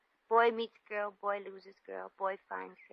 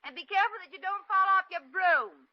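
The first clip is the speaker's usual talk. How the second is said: high, loud mock-sweet call; catty send-off; 9 semitones higher, 5 dB louder than usual. Shop girl seeing off her rival, catty send-off. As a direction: call it after her with a sting.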